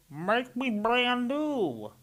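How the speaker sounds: high, slow, drawn-out voice; eerie slow voice. A narrator's reading. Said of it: "The voice from the puddle said".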